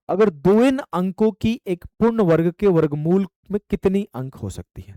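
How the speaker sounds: ordinary delivery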